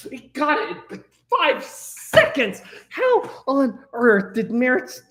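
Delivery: high, incredulous outburst; can't believe how fast they got it; shocked disbelief. Real, not acted. Streamer stunned that chat answered in five seconds, disbelieving.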